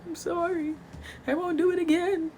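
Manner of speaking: imitating herself crying to dad; mock tearful. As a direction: squeak it out like a crying kid. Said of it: "damn near half the tears".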